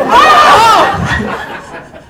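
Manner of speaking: loud, very high theatrical scream; hammed-up shriek of outrage; mock scream. Acted reaction to brutal comeback.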